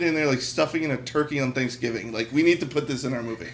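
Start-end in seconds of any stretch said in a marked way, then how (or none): none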